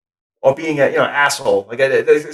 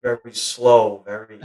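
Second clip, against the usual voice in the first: drawn out, sinking softer; slow and relaxed; 6 semitones lower, 7 dB quieter than usual. Shown as a demonstration. Actor showing his relaxed side, slow and soft. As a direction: let it slow right down and fade. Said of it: "difficult for me sometimes just be very".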